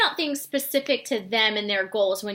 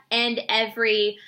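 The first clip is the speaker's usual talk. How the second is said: ordinary delivery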